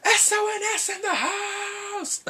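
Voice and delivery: very high, drawn-out excited holler; hype shout. Retold or imitated. Fan reacting to the next moment, high excited shout. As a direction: yell it like a hype man. Excited outburst recalling the show.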